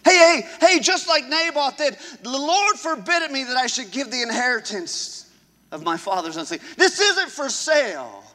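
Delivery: loud rallying preacher cry; preaching at full force. Acted. Pastor rallying the congregation to refuse, full-force preaching. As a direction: call the crowd to stand firm.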